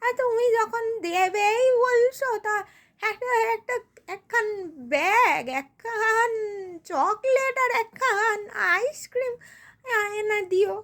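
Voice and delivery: put-on quavering old voice, feigning reluctance; elderly parent. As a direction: plead modestly like an ageing parent. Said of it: "আমি বুড়ো মানুষ".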